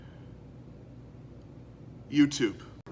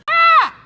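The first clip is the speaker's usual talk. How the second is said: sudden startled yelp; 10 dB louder than usual. Real, not acted. Startled by a mishap, didn't mean to.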